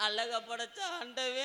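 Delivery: high, slow stagey wail; mock crying. Woman complains to the God who made beauty, high wailing. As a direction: stretch it into a high lament. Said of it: "அழுகாதடி".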